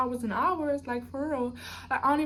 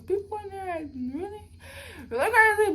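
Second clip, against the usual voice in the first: teary; 8 semitones higher, 4 dB louder than usual. Real, not acted. Recalling a scary jail scene.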